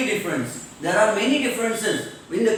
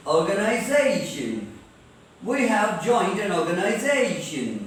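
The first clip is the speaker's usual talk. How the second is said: ORGANIZATION with a stretched "ZAY"; stress before -tion; 2 semitones lower than usual. Shown as a demonstration. Teacher demonstrating the rule, stretched stress on "ZAY". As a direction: lean on "za" in ORGANIZATION. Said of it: "उसको जोर से पढ़ना चाहिए".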